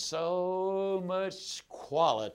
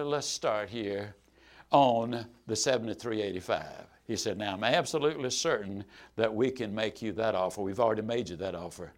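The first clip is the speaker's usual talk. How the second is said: firm salesman; 8 semitones lower, 4 dB quieter than usual. Retold or imitated. Dealer holds firm on price.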